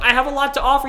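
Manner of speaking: loud, high-pitched retold protest; desperate plea. Rejected candidate pleading with interviewer, loud high desperate protest.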